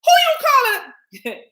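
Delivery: high, loud, slow indignant cry; indignant shriek. Retold or imitated. Someone offended by being called a mother lover, shrieking. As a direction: act offended at the name. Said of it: "somebody else might say".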